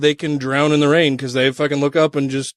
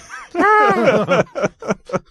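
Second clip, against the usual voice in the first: high laugh burst; 12 semitones higher than usual. Real, not acted. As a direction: crack up. Laughs after owl bit.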